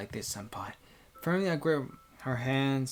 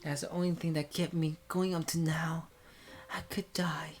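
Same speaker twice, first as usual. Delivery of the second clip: tearful, higher and whispery; crying voice; 6 semitones higher than usual. Acted. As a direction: plead through held-back tears. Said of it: "Suddenly she speaks in a crying voice".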